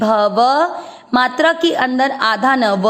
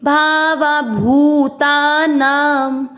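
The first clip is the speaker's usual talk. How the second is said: slow chanted verse, a little raised; Sanskrit recitation; 3 semitones higher, much slower than usual. Shown as a demonstration. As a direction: recite the half-line slowly for students. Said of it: "तीसरा चरण repeat".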